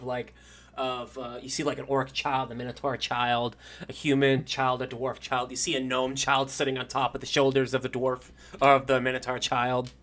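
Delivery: ordinary talk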